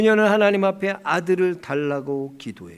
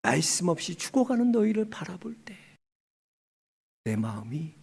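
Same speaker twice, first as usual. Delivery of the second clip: grieving, fading hush; 4 dB quieter than usual. Retold or imitated. God watching his people die, voice fading to sorrowful hush. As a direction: let the voice sink away in acted grief. God's grief after the rebuke.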